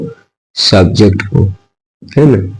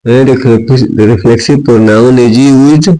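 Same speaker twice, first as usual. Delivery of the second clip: very slow, stretched words for copying; slow dictation; much slower than usual. Shown as a demonstration. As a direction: read it out word by word for notes. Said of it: "teacher dictating the definition". (धीमे-धीमे लिखवाना)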